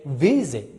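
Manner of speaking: weight the start of visit; visit, last syllable left unstressed; first syllable stressed. Shown as a demonstration. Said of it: "no stress".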